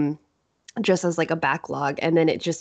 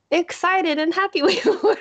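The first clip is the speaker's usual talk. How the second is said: laughing; 13 semitones higher than usual. Real, not acted. Recalling first episode, laughing.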